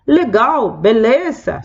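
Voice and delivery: "legal" said high with a falling "law" ending; chirpy casual approval; sounding easy-going and pleased; upbeat "legal, beleza". Shown as a demonstration. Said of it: "Son expresiones como diciendo".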